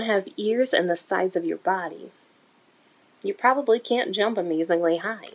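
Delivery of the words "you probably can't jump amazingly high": ordinary delivery, nothing marked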